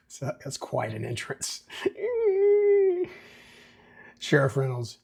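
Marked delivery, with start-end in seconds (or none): 1.7-3.3 very high, held squeal; imitated chalkboard screech. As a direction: mimic nails dragging down a chalkboard. Mimics nails scraping down chalkboard.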